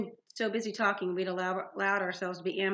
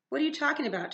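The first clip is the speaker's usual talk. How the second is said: mildly angry, higher-pitched demand; growled; 7 semitones higher, faster than usual. A narrator's reading. Girl challenges the manticore's claim, growling. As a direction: snap back with a touch of growl. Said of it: "Talia growled".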